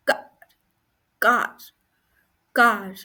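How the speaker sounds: कार said alone with an open "aa"; sounding out आ. Shown as a demonstration. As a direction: isolate each कार, long open vowel. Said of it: "कार में आप देख सकते हो आ का sound".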